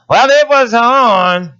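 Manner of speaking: high, slow, loud indignant retort; indignant cashier. Retold or imitated. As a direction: play the huffy cashier.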